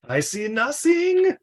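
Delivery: slow, high-pitched fake German accent; hammy European accent. Mocking the terrible accent in the film, hammy European accent. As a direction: ham up a bad European accent.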